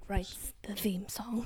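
whispered aside. Real, not acted. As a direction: hush it like a side note.

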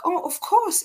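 high, bright eager agreement; delighted. Retold or imitated. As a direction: happily say yes. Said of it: "And I'm like".

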